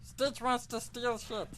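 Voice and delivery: playful character voice. Pretend. Joking about herself.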